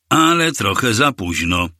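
usual speaking voice

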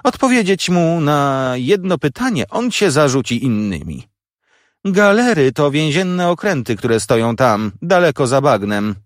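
high-pitched, exasperated sister; irritated scolding. A narrator's reading. Sister gives in to the boy's question, irritated scolding.

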